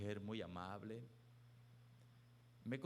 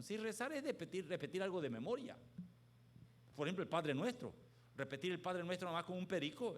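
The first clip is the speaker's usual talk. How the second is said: loud, high, forceful preacher voice; full-voiced sermon, building and louder; fiery preaching; 9 semitones higher, 8 dB louder than usual. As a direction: preach it out to the whole crowd.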